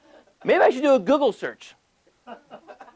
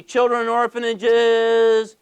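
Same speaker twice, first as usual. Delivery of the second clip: normal talking voice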